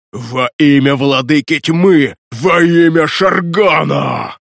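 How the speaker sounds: slow, building to a shout; crazed undead ranting. Acted. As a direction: rave like a possessed fanatic. Undead raves, overplayed.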